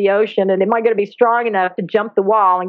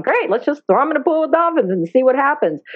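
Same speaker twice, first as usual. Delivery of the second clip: breezy enthusiasm; 6 semitones higher than usual. Retold or imitated. Retells own naive eager idea.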